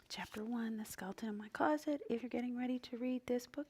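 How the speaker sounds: whispering the chapter title softly; barely voiced, no volume; whispered reading. Real, not acted. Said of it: "add some volume".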